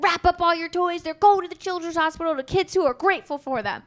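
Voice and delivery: put-on a father scaring his kids before Christmas; mock stern dad. Dad's mock threat after Christmas.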